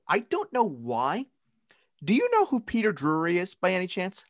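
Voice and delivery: ordinary talk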